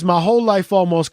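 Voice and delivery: ordinary talk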